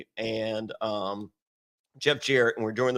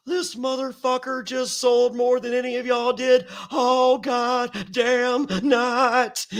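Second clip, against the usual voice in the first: Terry Funk promo; 9 semitones higher, slower than usual. Retold or imitated. Terry Funk praising him to the locker room, hollering. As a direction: ham up a grizzled promo cry. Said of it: "He goes".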